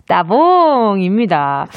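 slow drawn-out reveal. Shown as a demonstration. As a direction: stretch it out slowly.

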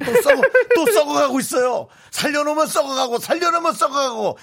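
loud, high laughing exclamation; laughing shout. Real, not acted. Laughing outburst at texts. (웃으며 외치기)

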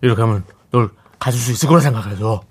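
talking through hot food; mouth full of hot food. Acted. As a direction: say it with a hot bite in your mouth. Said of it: "뜨거운 거 빨리 삼키고 얘기해".

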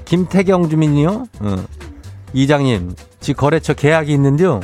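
Chungcheong dialect. Acted. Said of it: "행진리 이장인데요".

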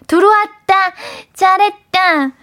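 hammed-up cutesy cheer for a listener; over-the-top aegyo. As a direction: squeal with delight, then stretch the praise. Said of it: "과한 애교 잘하지?".

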